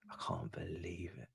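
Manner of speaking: whispered in disbelief; disbelieving whisper. Real, not acted. Streamer stunned by the game, whispering in disbelief. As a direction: breathe it out, stunned.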